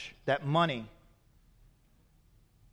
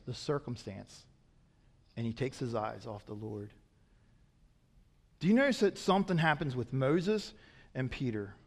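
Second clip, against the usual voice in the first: ordinary delivery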